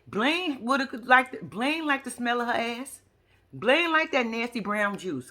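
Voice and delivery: usual speaking voice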